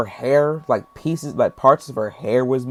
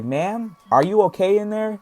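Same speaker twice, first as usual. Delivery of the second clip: higher, questioning call; mock concerned calling; 8 semitones higher than usual. Man calls to the woman in the porta potty, concerned. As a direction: check on someone through a door.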